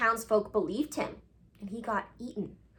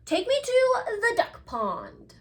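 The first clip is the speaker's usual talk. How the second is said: high, slow little owl demand; a small bird insisting, drawn out; 11 semitones higher than usual. Acted. Ozzy pleading his errand.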